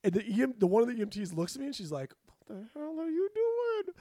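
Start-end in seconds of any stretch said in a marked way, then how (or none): 2.3-3.9 very high, slow, strained mock voice; hammed-up disbelief, pitched way up; incredulous EMT lady. EMT to untrained trainee, incredulous high voice.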